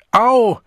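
very high, loud ouch; sudden sharp cry of hurt; yelp of pain. Acted. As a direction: yelp as if slapped. She is slapped.

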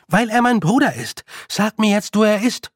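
exasperated, pitched well up; exasperated demand. Acted. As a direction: insist impatiently and demand an answer. Exasperated repeat, now aloud to Roland.

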